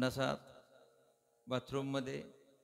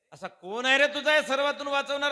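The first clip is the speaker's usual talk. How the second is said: loud taunting demand, louder; angry father's challenge; 9 semitones higher, 8 dB louder than usual. Acted. Demon king taunts his son Prahlad, angry and loud. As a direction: demand to know where his savior is.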